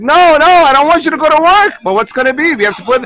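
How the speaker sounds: high, pleading and near tears, rushing the first plea; a wife half-crying as she protests. Retold or imitated. Wife begging her husband not to go to work, a touch tearful. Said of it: "but she starts crying".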